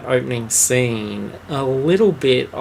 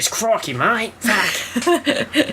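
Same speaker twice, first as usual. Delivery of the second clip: high, over-the-top Aussie voice; mock Australian accent; 10 semitones higher, much slower than usual. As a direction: play a cartoon Australian bloke. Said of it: "He's very Aussie at the beginning".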